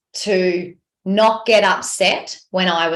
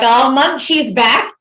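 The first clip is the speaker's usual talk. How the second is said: slow, higher, louder playful announcement; teasing husband; 5 semitones higher, 5 dB louder, slower than usual. Retold or imitated. Husband ribbing his moody wife, slow playful higher tease. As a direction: drawl it out like announcing a grumpy gremlin's return. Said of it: "he was like".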